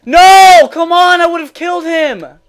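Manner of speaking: high, loud anguished yell; real gamer rage. Real, not acted. Gamer robbed of a kill, yelling in frustration. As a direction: cry out in furious protest. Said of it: "after raging that hard".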